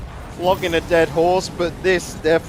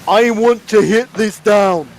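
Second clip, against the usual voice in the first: loud, raised, drawn-out speakerphone voice; hammed-up yell through a speakerphone; mock screaming; 4 semitones higher, 6 dB louder, slower than usual. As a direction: shout it slowly as if distorting the mic. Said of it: "You're screaming out with a speakerphone".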